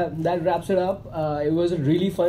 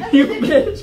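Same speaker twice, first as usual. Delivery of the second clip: mock angry yell; 12 semitones higher, 6 dB louder than usual. Mock curse retold, loud.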